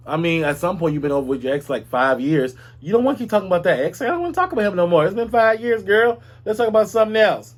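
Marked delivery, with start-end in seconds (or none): 2.8-7.5 high, fast sassy friend; higher, quicker mock girlfriend. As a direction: rattle it off like a fed-up girlfriend. Voicing friend tired of ex talk.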